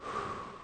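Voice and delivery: long, slow exhale; soft, acted deep breath; settling sigh. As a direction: breathe out before praying. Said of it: "just being like".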